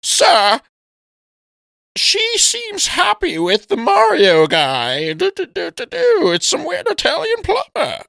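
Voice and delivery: high, slow, nasal-free toady servant voice; lightly hammed-up high minion voice; mock Kamek voice. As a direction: play the fussy wizard minion reporting to the boss. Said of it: "he's like".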